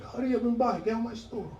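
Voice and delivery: high, mock Chinese-accented voice; Chinese shopkeeper impression. Chinese shop owner shoos him out, high mock Chinese accent. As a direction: imitate a Chinese storekeeper hurrying a customer out. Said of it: "conversations with Chinese people".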